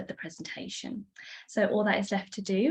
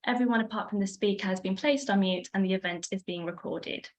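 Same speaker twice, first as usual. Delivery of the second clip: usual speaking voice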